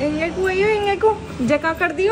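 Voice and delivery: put-on rough rustic Haryanvi accent. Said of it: "अपने Haryanvi tone में डांट दो".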